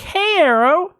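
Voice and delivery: high-pitched cooing call; sing-song pet call. Retold or imitated. Neighbors come out and greet their cat, high sweet call.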